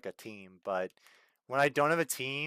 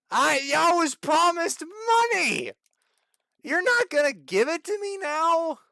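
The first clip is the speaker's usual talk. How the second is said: much higher, louder whining protest; outraged whine; 11 semitones higher, 7 dB louder than usual. Real, not acted. Streamer protesting to the game about missing money, whining. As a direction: wail like you were cheated.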